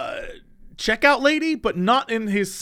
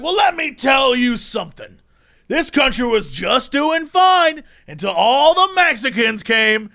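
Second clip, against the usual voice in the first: slow, raised-pitch tirade; mock angry rant; 3 semitones higher, slower than usual. Shopper lecturing store staff, mock angry tirade. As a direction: imitate an indignant ranter, hammering words.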